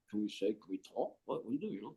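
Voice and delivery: barely audible, whispering under the breath; mock-nervous recruit whispering; hushed whisper. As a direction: whisper so the bus lady won't hear. Silent recruits on the bus.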